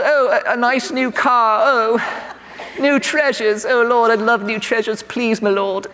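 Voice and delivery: hammed-up British wishful pleading; British accent. Inner voice begging the Lord for treasures, in a British accent. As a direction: play a hopeful Brit asking for gifts. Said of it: "for some reason it's British".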